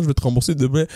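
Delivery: usual speaking voice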